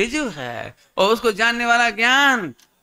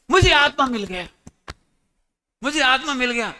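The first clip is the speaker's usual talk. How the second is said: elated announcement; 5 semitones higher than usual. Acted. Son announces finding the soul.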